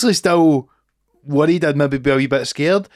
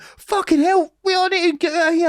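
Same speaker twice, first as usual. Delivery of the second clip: put-on panicked kid. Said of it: "me going, like".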